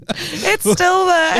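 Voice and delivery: high, giggly squeal; laughing. Real, not acted. Both laughing about the image.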